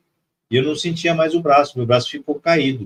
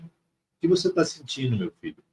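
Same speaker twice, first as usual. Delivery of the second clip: very gentle voice; 6 dB quieter than usual. Retold or imitated. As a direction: ask gently, softening towards the end. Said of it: "com uma voz muito suave". (voz muito suave)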